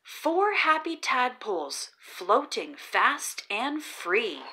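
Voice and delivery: ordinary delivery, nothing marked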